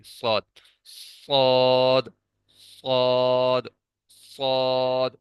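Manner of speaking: صاد thick and heavy, teeth pressed together; full heavy letter. Shown as a demonstration. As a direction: hold صاد full and heavy, the last one long. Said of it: "صاد کو پر کر کے موٹا کر کے پڑھیں گے".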